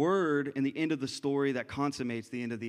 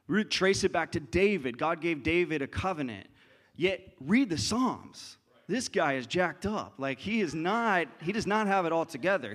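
louder, driving sermon; preaching at full force; 3 semitones higher, 4 dB louder than usual. Acted. Pastor telling the church to read David's Psalms, louder. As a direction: drive the point home.

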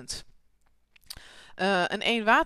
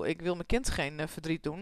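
usual speaking voice

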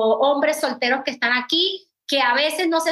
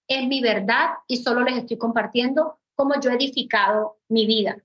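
usual speaking voice

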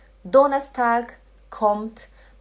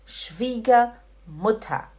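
very slow, split into two parts; slow pronunciation model; much slower than usual. Shown as a demonstration. Teacher models a long German word for students, slowly and deliberately. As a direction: say it slowly for learners to repeat. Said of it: "Fala Schwiegermutter".

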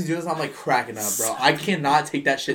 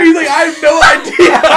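laughing retold quote; 13 semitones higher, 14 dB louder than usual. Imitating Evan admitting it to a coworker, laughing loudly. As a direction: shout the punchline while cracking up. Retelling Evan's punchline while laughing.

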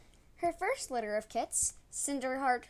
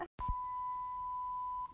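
high giggle; 9 dB quieter than usual. Real, not acted. Giggle breaking off a flubbed take.

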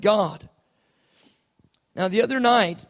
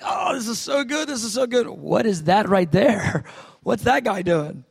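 excited exclaiming. Retold or imitated. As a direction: gush with delight at the service. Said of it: "I remember being like".

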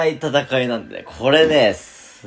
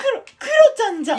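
squeaky falsetto catchphrase; very high, childlike put-on voice; Kuro-chan; faster than usual. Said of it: "常にクロちゃんで行く". (甲高い裏声のモノマネ)

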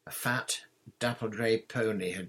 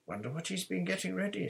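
quick, higher-pitched worry; note of anxiety; 10 semitones higher, faster than usual. A narrator's reading. Boy wonders to his friends about the new teacher, anxious. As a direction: let unease creep into the question. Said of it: "a note of anxiety in his voice".

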